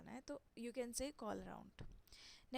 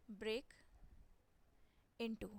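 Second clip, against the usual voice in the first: slow word drill; much slower than usual. Shown as a demonstration.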